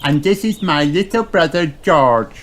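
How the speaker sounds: put-on high piglet girl voice; proud little sister, pitched high; Peppa Pig. A narrator's reading. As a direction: Peppa showing off her brother. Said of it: "snorts Peppa".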